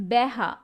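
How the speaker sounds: বেহা repeated with a clear "be" opening; corrected dialect pronunciation. Shown as a demonstration. Teacher confirms how the dialect word is pronounced, careful demonstration. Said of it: "এটা উচ্চারণ হবে".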